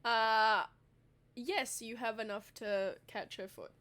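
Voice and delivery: usual speaking voice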